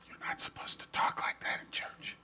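hushed whispered warning; mother silently fretting in church, whispered; whispered scolding. Retold or imitated. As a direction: whisper it like a worried mother in a pew. Mother's worried thought in church.

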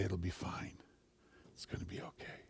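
barely audible, comforting whisper; soothing whisper. Retold or imitated. People who come in to comfort a little one, whispered reassurance. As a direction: whisper it gently to calm someone. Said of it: "When Jesus whispers it".

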